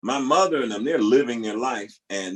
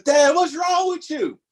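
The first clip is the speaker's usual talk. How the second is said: much higher, loud shout at dad; sister's call; 4 dB louder than usual. Retold or imitated. Sister shouting at the naked father, high and loud. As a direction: call out to her startled father. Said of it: "Cherie says".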